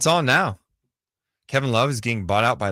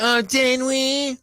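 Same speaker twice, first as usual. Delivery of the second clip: high, drawn-out mock surprise; sarcastic; 13 semitones higher, 4 dB louder, much slower than usual. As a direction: stretch it out slowly in a high, sarcastic voice. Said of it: "It felt super sarcastic".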